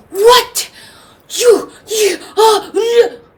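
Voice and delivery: high, loud, stammering shock; sputter, too outraged to finish; indignant sputtering. Acted. Grandfather waking to the sun released, loud flustered sputter.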